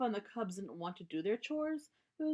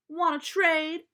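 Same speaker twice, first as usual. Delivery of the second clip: high, loud, slow challenge; challenging sister; 9 semitones higher, 11 dB louder, slower than usual. Acted. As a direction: dare him to swap. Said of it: "asked Sister".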